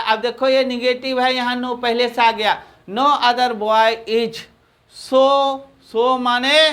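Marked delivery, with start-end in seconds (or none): 2.9-4.4 slow dictation. Shown as a demonstration. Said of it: "teacher dictates slowly".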